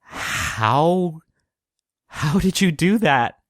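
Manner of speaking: put-on high, slow, unaffected natural accent; Kravitz's non-affected accent. Kravitz faces Taco again, stunned, in his unaffected accent. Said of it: "in his non-affected accent".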